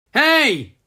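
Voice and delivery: loud high hey; calling out. A narrator's reading. Rocky calling down the silo hatch, loud. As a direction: call down from above. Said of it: "came a voice from the hatch".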